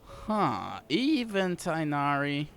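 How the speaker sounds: ordinary talk, nothing marked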